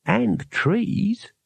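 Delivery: friendly tree. A narrator's reading. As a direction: chime in helpfully, ending on a rise. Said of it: "said a friendly voice from the branches".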